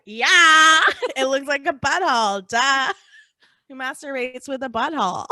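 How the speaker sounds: loud laughing. Retold or imitated. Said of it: "And she's like".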